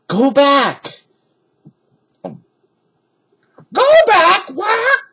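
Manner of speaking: very high, loud parrot squawk. A narrator's reading. Parrot warns the children, high squawk. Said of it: "she squawked".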